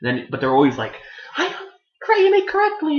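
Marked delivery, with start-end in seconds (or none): 1.4-3.0 very high, put-on squeaky voice; mock character voice. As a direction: imitate him in a silly high pitch.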